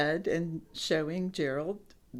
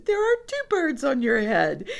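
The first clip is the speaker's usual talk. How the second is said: piggy's announcement; 7 semitones higher, 4 dB louder than usual. A narrator's reading. Narrator repeats piggy's line.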